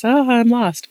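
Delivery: a lightly voiced frightened child, high; panicked kid. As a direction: mimic a kid realizing they're lost.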